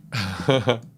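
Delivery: brief, amused chuckle; short laugh. Real, not acted. Amused relief that stream still runs.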